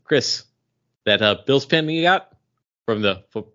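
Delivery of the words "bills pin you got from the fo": ordinary delivery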